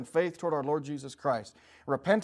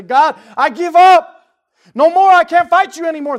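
voicing a surrendering sinner; 22 dB louder than usual. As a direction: cry out in surrender.

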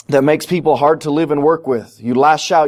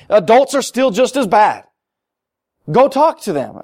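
preaching rebuke; 6 semitones higher than usual. Acted.

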